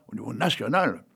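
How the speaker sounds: normal talking voice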